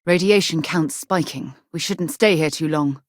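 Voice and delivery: usual speaking voice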